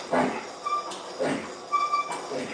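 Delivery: ordinary talk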